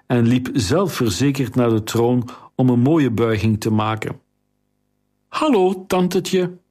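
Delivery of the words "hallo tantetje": put-on higher, grinning young boy's voice; a lightly voiced cocky lad; cheeky boy. A narrator's reading. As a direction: play the brash nephew greeting his aunt.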